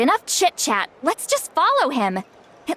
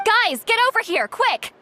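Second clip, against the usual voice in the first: urgent call; 8 semitones higher than usual. Acted. Calling others over urgently.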